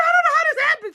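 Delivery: very high mock wail; hysterical. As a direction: imitate helpless crying, hammed up. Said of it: "I am hysterical".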